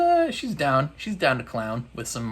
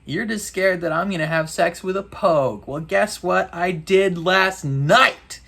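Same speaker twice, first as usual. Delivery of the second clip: defiant taunt; 6 semitones higher, 4 dB louder than usual. Retold or imitated. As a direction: drag out the taunt at mom. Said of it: "she's just like".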